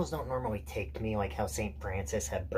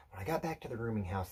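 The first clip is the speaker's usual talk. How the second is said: ordinary delivery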